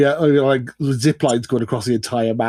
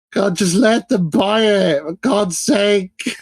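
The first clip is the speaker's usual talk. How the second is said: exasperated; 8 semitones higher, much slower than usual. Real, not acted. Exasperated rant about the deal.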